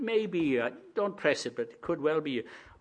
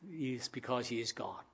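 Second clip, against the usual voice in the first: normal talking voice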